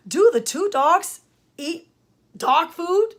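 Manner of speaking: slow question, lifting on "food"; question intonation. Shown as a demonstration. Teacher showing parents and student a question, rising intonation. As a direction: read it as a question, voice going up on "food". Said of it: "My voice went up at the end".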